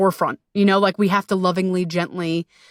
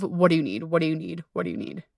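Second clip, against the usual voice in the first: repeated ever softer until almost gone; fading whisper; 3 semitones lower, 6 dB quieter, faster than usual. Shown as a demonstration. Asking her body what it needs, fading softer. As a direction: ask it again and again, fading into silence. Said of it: "ask your body".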